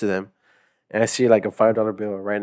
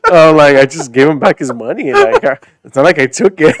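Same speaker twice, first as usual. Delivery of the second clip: words spilling out through laughter, louder; laughing disbelief at his own story; laughing while talking; 5 semitones higher, 12 dB louder than usual. Real, not acted. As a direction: keep laughing as you explain.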